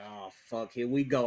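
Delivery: soft, defeated under-the-breath curse; resigned mutter. Retold or imitated. Fan seeing his pick gone, muttering softly in resignation. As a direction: mutter it like you saw it coming. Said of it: "so I was like".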